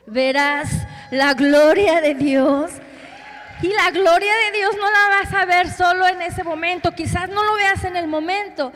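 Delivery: loud, high preaching shout; impassioned preacher's climax; fervent proclamation. Real, not acted. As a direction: proclaim it to the whole congregation at full force.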